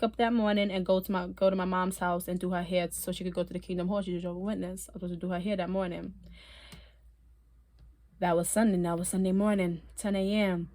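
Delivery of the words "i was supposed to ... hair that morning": ordinary talk, nothing marked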